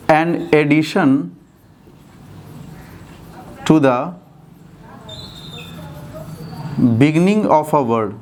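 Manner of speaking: very slow, in separate chunks with long pauses; slow dictation. Shown as a demonstration. Teacher giving students a definition to write, slowly dictated. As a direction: dictate phrase by phrase, waiting after each.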